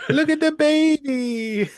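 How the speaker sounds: high, drawn-out squeal of delight; cooing excitedly. Real, not acted. Podcast guest spots a baby, cooing in delight. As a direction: coo it high, stretching the last word.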